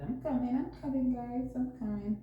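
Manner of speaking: calling out from another room. Real, not acted. Calling out from away.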